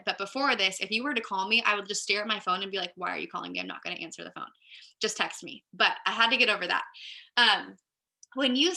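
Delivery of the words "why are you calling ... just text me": low, much softer inner grumble; reluctant muttering. Retold or imitated. Introvert staring at a ringing phone, reluctant low mutter. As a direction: mutter it to yourself, pitch dropped.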